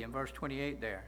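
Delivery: ordinary talk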